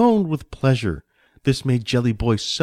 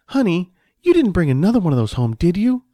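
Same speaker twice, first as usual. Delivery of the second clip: high, loud wifely reproach; exasperated wife; 8 semitones higher, 5 dB louder than usual. Acted. Wife chides husband for bringing another one home, exasperated. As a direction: pitch it up into a nagging question.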